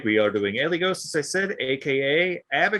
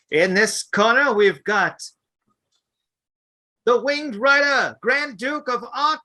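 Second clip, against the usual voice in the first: boxing ring announcer; 8 semitones higher than usual. Shown as a demonstration. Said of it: "read it like you're a boxing announcer".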